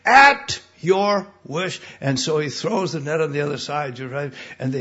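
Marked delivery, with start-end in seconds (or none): 0.0-1.8 very slow, loud surrender; loud, very slow giving in; slow yielding. Retold or imitated. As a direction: draw it out, giving in. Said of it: "he says".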